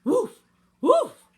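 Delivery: two loud, high imitated woofs; dog bark. A narrator's reading. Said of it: "barked the dog".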